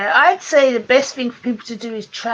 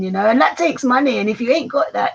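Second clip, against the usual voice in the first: ordinary talk, nothing marked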